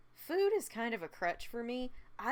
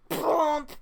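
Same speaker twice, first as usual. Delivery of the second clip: crash sound; 7 semitones higher, 12 dB louder than usual. Retold or imitated. Imitating cartoon crash impact.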